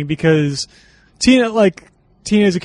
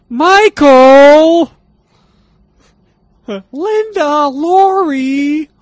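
calling out; 10 semitones higher, 7 dB louder, much slower than usual. Acted. Calling out names like the film.

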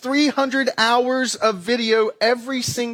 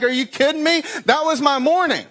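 high, disbelieving cry; incredulous outburst; 7 semitones higher than usual. Retold or imitated. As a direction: react as if the video hit home. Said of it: "you're like".

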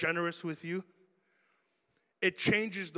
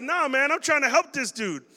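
higher, louder defensive push-back; indignant protest; 7 semitones higher, 4 dB louder than usual. Retold or imitated. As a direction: insist you meant well. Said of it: "you're like".

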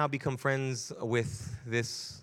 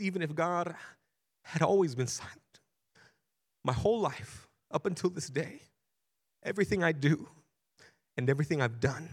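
overwhelmed confession; 6 semitones higher than usual. Retold or imitated. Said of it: "become overwhelmed".

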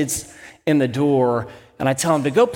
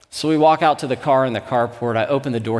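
usual speaking voice

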